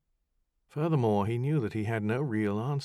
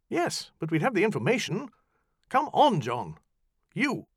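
raised, pushy insistence; high, demanding push; insistent; 12 semitones higher, 6 dB louder than usual. Acted. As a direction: override his doubt. Farrar insists, then orders.

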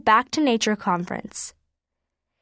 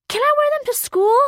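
put-on much higher, eager child's voice; hopeful little boy; 4 dB louder than usual. A narrator's reading. Little boy pleading to wear mitts to school, high and eager. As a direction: plead like an excited small kid.

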